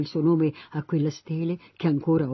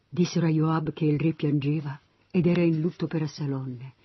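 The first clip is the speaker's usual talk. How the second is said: plain reading voice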